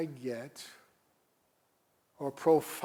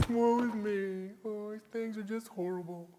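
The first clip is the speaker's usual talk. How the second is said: higher, put-on wailing prophet; mock lament; 7 semitones higher than usual. Pastor voicing Habakkuk's complaint, hammed-up lament. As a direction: moan it like a prophet in despair. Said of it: "the book, he goes".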